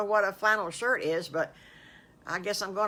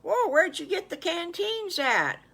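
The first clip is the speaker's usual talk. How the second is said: high, loud astonished question; amazed wolf; 12 semitones higher, 7 dB louder than usual. Acted. Wolf spots his friends' new canteens, amazed. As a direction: blurt it out in wide-eyed wonder.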